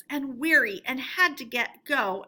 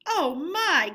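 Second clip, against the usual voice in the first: higher, a touch of panic; mild gasp; 6 semitones higher, 4 dB louder than usual. A narrator's reading. Toad gasping in the river, mildly alarmed. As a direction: gasp in the water. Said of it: "gasped poor Toad".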